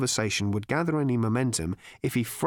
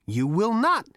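defiant Zaphod; 9 semitones higher, 4 dB louder than usual. Acted. Zaphod defies the robot.

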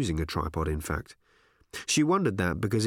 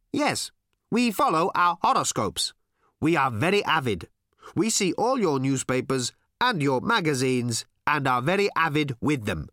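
high, deliberate alien voice; solemn alien, each phrase set apart; stilted, emphatic alien statements; earnest alien visitor; 5 semitones higher, 4 dB louder than usual. Acted.

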